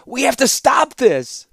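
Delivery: high, slow, urgent cry; urgent plea. A narrator's reading. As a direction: plead loudly to stop him. Urgent plea amid battle.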